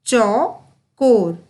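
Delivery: चौकोर split as चौ, कोर with a pause; slow syllable by syllable. Shown as a demonstration. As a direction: sound out चौकोर slowly, part by part. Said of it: "उच्चारण पर बहुत ही अधिक ध्यान". (चौ-कोर को तोड़कर धीरे-धीरे बोलना)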